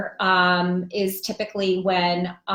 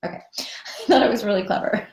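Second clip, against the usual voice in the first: laughing. Real, not acted. Laughing at own pun.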